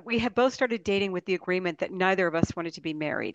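ordinary delivery

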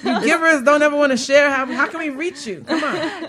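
high, loud teasing through laughter; laughing tease. Real, not acted. Host teasing guests to plug themselves, laughing. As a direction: laugh while nudging them to share.

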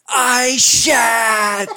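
loud, high, agonized mock confession; melodramatic cry. Imitating drunk man's unforgettable confession, hugely dramatic cry. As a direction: cry it out like a film's tragic climax. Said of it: "he was in Planet of the Apes".

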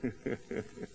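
low, soft scheming snicker; mock sinister chuckle. Preacher plays baby plotting trouble, quiet villainous chuckle.

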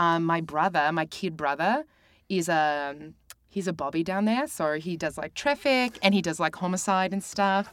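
chatty put-on New Zealand accent. Shown as a demonstration. Guest chatting about her kid brother, in a New Zealand accent. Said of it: "I can do a New Zealand accent".

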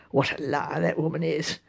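low, soft disparaging aside; scornful aside. Acted. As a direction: mutter your disdain under your breath.

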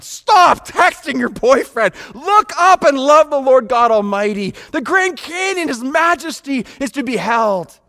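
high, loud urging; fervent preaching. Real, not acted. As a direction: press the point home fervently. Preaching at full force.

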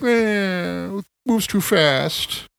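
high, drawn-out mocking whine; slow, exaggerated high-pitched grumble; mock complaining. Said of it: "they're complaining about it".

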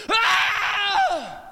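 put-on high, loud little-girl scream; child's scream. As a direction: let out a sudden shriek like a hurt child. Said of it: "Lucy has this scream".

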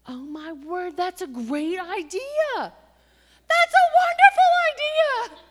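slow, soaring shout of delight; overjoyed exclamation. Retold or imitated. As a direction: burst out with overjoyed relief. Sudden joyful realization.

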